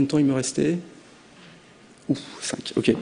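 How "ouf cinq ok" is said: "Ouf, cinq" breathed out as a hushed relieved aside; whispered aside. Real, not acted. Speaker told the time left, whispered relieved aside. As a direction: drop to a whisper, a private reaction to the time left.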